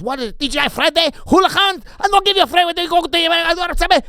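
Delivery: high, loud Italian chef yelling in broken English; crazy Italian chef. Retold or imitated. Italian chef shouting at the waiter, high and loud. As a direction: bark it like a wild Italian cook, way up high. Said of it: "a crazy coked out guy from Italy".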